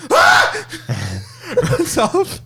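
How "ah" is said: very high, loud shriek of fright; a panicked child's scream; kid scream. Retold or imitated. Kid running off from the scare actor, high shriek. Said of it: "and he's like".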